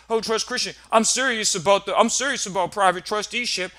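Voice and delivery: lightly hammed-up insincere promise; mock earnest client. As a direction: plead like someone faking commitment.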